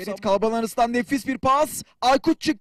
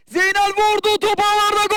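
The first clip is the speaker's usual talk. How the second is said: roaring goal call, high and loud; screaming football commentator; 8 semitones higher, 5 dB louder than usual. Real, not acted. Goal scored in live commentary.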